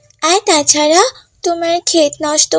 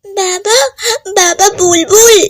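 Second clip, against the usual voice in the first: tearful call to father, pitch raised; crying; 4 semitones higher than usual. Acted. Child crying to father about lost Bulbul, tearful. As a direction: cry out for father in distress. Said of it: "কান্না করছো কেন?".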